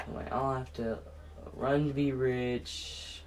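ordinary talk

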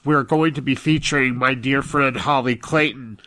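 usual speaking voice

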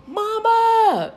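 high, loud, drawn-out call; excited yell. Retold or imitated. Son calling to his mother after winning his game, excited yell. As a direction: yell it out like a thrilled son spotting mom. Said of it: "he just yelled".

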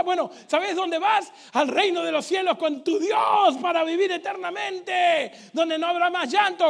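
loud, rising preacher's call; preacher rousing the church about heaven, loudly; preaching. Acted. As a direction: preach it out to the congregation. Preaching at full force.

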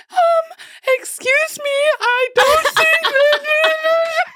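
high, shrill-polite panicked mom voice; mom impression. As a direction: fall apart while acting polite. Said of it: "I love your impression of your mom".

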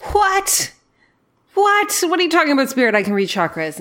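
high squeaky what, what; stunned and confused, voice jumping up; shocked disbelief. Retold or imitated. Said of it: "And I was like".

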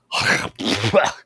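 loud throaty hawk and spit; choke, then spit it all out; hacking spit. Acted. Big frog clearing mouth of tail feathers, hacking spit.

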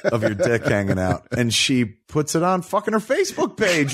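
ordinary delivery

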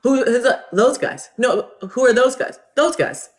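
high-pitched comic exchange; comic back-and-forth. Acted. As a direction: act out a baffled double-act routine. Acting out Who's on First routine.

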